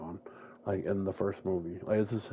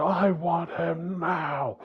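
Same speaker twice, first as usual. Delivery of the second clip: very slow, loud, high; menacing demand; 9 semitones higher, 5 dB louder, much slower than usual. Retold or imitated. Jalen demanding his brother, menacing. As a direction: spit out each word slowly.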